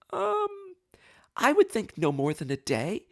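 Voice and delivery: hesitant high "um", then unsure; tentative answer. Retold or imitated. Nervous defendant answering the judge, tentative.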